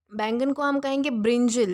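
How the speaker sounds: ordinary talk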